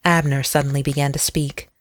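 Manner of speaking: ordinary delivery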